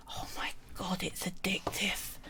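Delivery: hushed, breathy confession to her viewers; whispered aside. Real, not acted. Crafter whispering to her stream about her new game addiction. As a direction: whisper it like a guilty secret.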